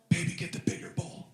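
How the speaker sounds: put-on hushed, voiceless aside to the kid; whispered aside. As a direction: whisper it to the baby. Dad aside to son.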